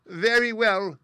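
high, loud tiny regal voice; squeaky mouse queen. A narrator's reading. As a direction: agree like a little queen.

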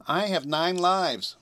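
high, slow kitten voice; purring kitten. A narrator's reading. Kitten reassuring the girl on the roof, purring. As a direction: purr it out slowly, pitched high. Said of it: "purring softly".

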